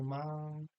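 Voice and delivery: माँ stretched out as a call; calling माँ with a lengthened aa; long drawn vowel. Shown as a demonstration. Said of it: "स्वर को लंबा खींचने".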